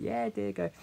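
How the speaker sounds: mock sweet, high-pitched indulgence; indulgent reply. Support worker waving a child off to smoke, mock indulgent.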